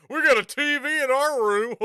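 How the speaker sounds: mock proud. Shown as a demonstration. Streamer mocking his own old-fashioned brag about the TV. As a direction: announce owning a TV like it's nineteen ninety-five. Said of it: "like it's fucking nineteen ninety-five".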